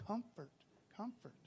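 soft, tender second take; soft comfort. Shown as a demonstration. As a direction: say comfort softly, almost to yourself. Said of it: "Let me go ahead and say that twice".

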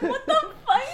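giggly stunned question; laughing disbelief. Retold or imitated. Retold shock, laughing.

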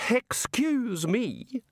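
high, drawn-out interruption; restrained sharpness. Acted. Missus Whitlow interrupting the wizards, politely sharp. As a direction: demand attention, sharply but politely. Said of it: "in as sharp a tone".

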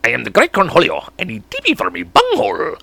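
ranting cartoon voice, jumping upward; Beavis. Practicing a cartoon voice for listeners, manic impression. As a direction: do the manic cartoon alter ego. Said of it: "I wanna get that voice down".

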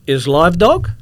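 loud, high astonished question; broken-English question, voice rising at the end; imitated foreign security guard. Guard pointing at x-ray again, loud astonishment. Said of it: "He goes, he points again".

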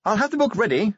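high-pitched anxious lady; Sybil's voice. A narrator's reading. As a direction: keep Sybil up high and brisk. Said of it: "said Lady Sybil".

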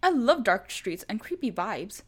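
loud, slow overdone confidence; fake bravado. A narrator's reading. Scared boyfriend faking bravery, loud and slow. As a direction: oversell the courage.

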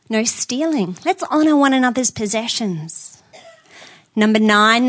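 usual speaking voice

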